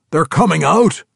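hearty dwarf, pitched high and loud; excited dwarf telling the drow. Acted. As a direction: boom out the news like a brash dwarf.

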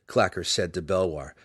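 plain reading voice

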